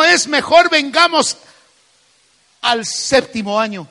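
rising pulpit fervor; preaching at full force. Acted. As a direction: pastor driving the message home, voice climbing. Preacher builds to climax.

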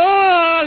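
high, loud drawn-out "oh"; hammed-up wailing "oh"; imitated cry. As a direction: mimic someone crying out at a video. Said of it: "veía el video y hacía".

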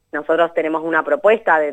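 normal talking voice

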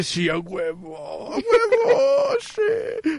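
drawn-out, high celebratory cry; hammed-up triumphant whoop, stretching the words; man's inner cheer. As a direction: cheer like an elated guy, voice climbing high. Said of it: "Y como hombre".

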